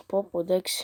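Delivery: ordinary talk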